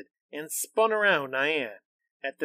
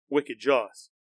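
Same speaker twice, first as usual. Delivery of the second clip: plain reading voice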